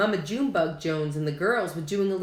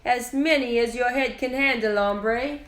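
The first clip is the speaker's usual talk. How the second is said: put-on higher voice in a Spanish accent; 6 semitones higher, 3 dB louder than usual. A narrator's reading. Skippy answers his own mirror, in a Spanish accent. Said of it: "using his very best Spanish accent".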